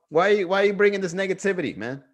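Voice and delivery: ordinary talk, nothing marked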